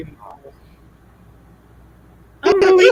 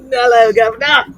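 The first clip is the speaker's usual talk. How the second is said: loud mock Cockney chimney sweep; cheeky put-on Cockney greeting; 5 dB louder than usual.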